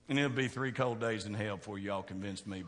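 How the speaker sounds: ordinary talk, nothing marked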